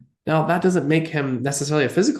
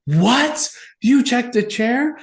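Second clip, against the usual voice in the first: much higher, slow disbelieving question; mock shock; 10 semitones higher, 3 dB louder, much slower than usual. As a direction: draw it out in caught-out disbelief. Medium shocked at being caught.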